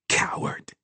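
whispered taunt; accusing inner voice. A narrator's reading. Voice in his mind accuses him, whispered. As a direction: whisper it like a voice inside his head. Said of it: "said a voice in his mind".

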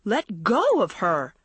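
urgent cried command; alarmed demand to release her; crying out. A narrator's reading. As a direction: order it, swooping up on GO. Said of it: "Andrea cried to Gina".

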